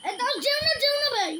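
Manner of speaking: loud urgent plea; calling out. Real, not acted. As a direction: shout after him, pleading. Friend leaving, calling him back.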